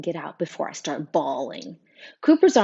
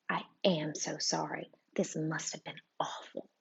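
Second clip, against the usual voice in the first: much softer, gentle teacher voice; softened, sympathetic; 12 dB quieter than usual. A narrator's reading. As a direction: soothe him, hushed and kind. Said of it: "her tone softening".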